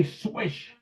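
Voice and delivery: high, soft little swish; a kid calling his own shot, softly and high; kid's swish. Retold or imitated. Boy on the street court calling his good shot, soft and high. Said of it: "I would say".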